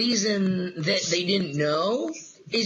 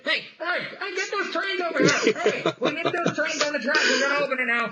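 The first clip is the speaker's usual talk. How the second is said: calling the crew; 8 semitones higher than usual. Retold or imitated. Worker calling crew for trains.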